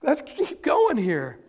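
very high sarcastic hello, then slow urging; mock exasperation at the delay; impatient hello. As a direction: Jairus hurrying Jesus along, exasperated. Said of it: "Jairus is like".

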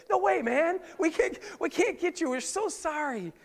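high, hammed-up apology; comic crowd voice. Preacher voicing the crowd refusing the friends, high and comic. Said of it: "That's how they talk back then".

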